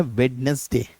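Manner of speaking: वेडनेसडे with D and NES sounded, "wed-nes-day"; common mispronunciation. Shown as a demonstration. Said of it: "अक्सर गलत तरीके से pronounce".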